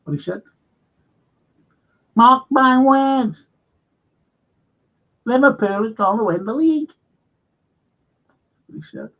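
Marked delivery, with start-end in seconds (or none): 2.2-6.9 put-on Mark Goldridge accent. Mimicking a football pundit's league prediction in his accent. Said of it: "In that sort of accent".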